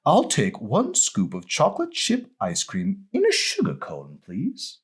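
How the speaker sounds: a hint of a prim British lady; Julie Andrews type accent. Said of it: "She had a Julie Andrews type of accent".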